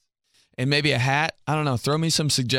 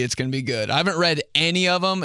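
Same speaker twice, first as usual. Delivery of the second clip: usual speaking voice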